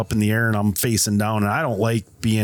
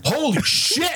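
high-pitched burst of astonishment; drawn-out, amazed mock exclamation; retold shocked exclamation; 13 semitones higher than usual. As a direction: imitate a friend's stunned reaction. Retold friend's shock at tilted car.

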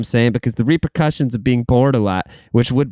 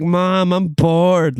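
a hint of a whiny kid, pitched up; 7 semitones higher, much slower than usual. Kid complaining to mom, mildly whiny and high. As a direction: whine it like a bored child. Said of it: "Remember being like".

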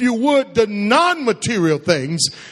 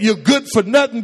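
usual speaking voice

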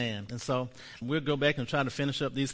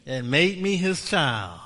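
slow confession; 5 semitones higher, 5 dB louder, slower than usual. Shown as a demonstration. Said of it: "Say this with me".

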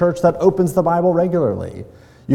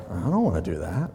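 low, soft reluctant mumble; quietly balk, like a grudging listener; a subdued, unwilling reply; 8 semitones lower, 7 dB quieter than usual. Acted. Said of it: "And we're like".

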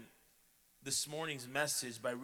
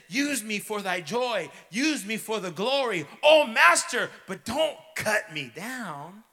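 anguished begging; 8 semitones higher, 13 dB louder than usual. A narrator's reading. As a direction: beg the master not to cut. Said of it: "A trembling of great horror".

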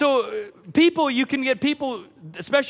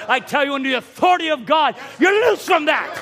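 preaching shout; 4 semitones higher than usual. Real, not acted. Preacher declares the congregation free, shouting. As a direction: declare it with full authority.

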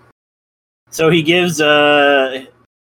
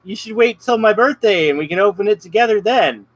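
put-on high, hurried kid voice stalling her; a boy anxiously putting her off; imitating the kid; 7 semitones higher than usual. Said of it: "And he's like".

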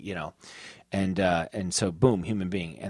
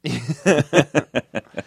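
burst of laughter; 4 semitones higher, 6 dB louder than usual. Real, not acted. Laughs at friend's joke remark.

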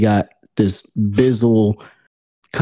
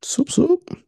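playful; 4 dB quieter than usual. Pretend. Playful sound effect words.

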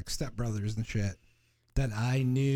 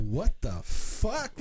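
shocked; 4 semitones higher, much slower than usual. Retold or imitated. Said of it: "I was just like".

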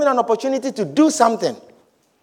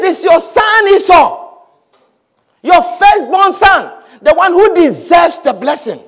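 Esau pleading; 7 semitones higher, 5 dB louder than usual. Acted. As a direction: call out eagerly to your father. Esau voiced again.

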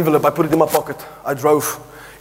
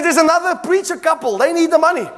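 insistent wife; 8 semitones higher than usual. Retold or imitated. Wife's reply in argument.

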